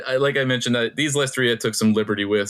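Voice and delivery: ordinary talk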